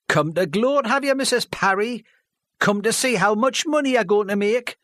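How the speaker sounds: high, loud mocking jeer playing to the crowd; showing-off taunt. A local man taunting the widow before the village hall, mocking. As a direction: sneer at her like a child showing off. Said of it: "like a child showing off".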